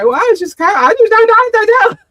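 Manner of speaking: very high, loud garbled whine; exaggerated high-pitched fluster; mock flustered babble. Imitates herself failing to confront.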